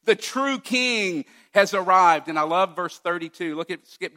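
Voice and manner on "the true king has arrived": higher, louder, slower proclamation; triumphant announcement. Retold or imitated. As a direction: herald a long-awaited king. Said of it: "as if the author is saying".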